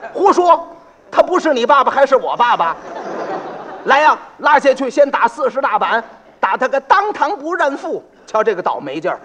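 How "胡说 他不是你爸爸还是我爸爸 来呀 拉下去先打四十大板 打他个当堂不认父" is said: high, loud angry shout with laughter; angry magistrate. Acted. Magistrate orders the pot seller beaten, shouting angrily. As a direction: roar the sentence at him.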